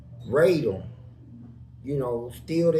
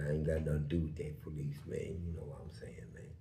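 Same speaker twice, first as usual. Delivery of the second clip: very low, hushed retold voice; retold low reply; 13 semitones lower, 13 dB quieter, faster than usual. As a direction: drop low and soft, nearly under the breath. Retold reply of the other man.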